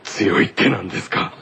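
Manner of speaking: low, slow character voice; boxing Kaido. Acted. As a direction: growl it low like a boxer. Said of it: "ボクシングをしている、えー、カイドウ".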